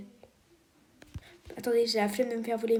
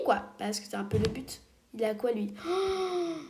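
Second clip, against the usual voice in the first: ordinary talk, nothing marked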